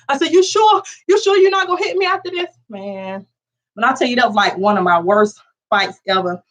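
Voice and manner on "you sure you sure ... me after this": anxious high question. Retold or imitated. Anxious kid asking sister.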